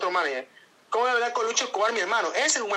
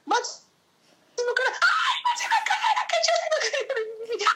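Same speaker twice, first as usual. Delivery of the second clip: very high, slow, laughing squeals of joy; overjoyed squealing; much slower than usual. Real, not acted. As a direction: squeal the name out in delight. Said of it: "a veces me emociono por eso".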